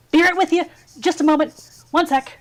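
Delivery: high, loud call from upstairs; young shopkeeper calling out from the loft. Acted. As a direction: shout down cheerfully from the loft. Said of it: "you hear this young male voice going".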